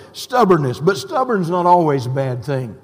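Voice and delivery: normal talking voice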